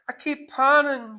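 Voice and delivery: high, loud mock pining; pining widow. Kitty complaining to the narrator, high mock pining. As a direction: lament it in a high wail. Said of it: "she says".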